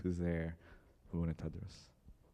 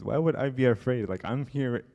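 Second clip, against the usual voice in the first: loud, higher-pitched acted bafflement; confused incredulity; 4 semitones higher, 10 dB louder than usual. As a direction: protest as if totally baffled, imitating him.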